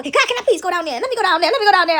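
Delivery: high, loud eager begging; hammed-up pestering plea; Satan. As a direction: keep begging like an impatient child. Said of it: "You know that Satan gon".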